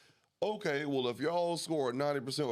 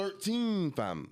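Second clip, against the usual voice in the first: London roadman accent; 9 semitones higher, much slower than usual. Shown as a demonstration. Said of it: "English accent, bro".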